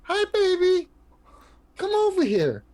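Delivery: high coaxing pet voice; baby talk to cat. Acted. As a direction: coax the cat over sweetly.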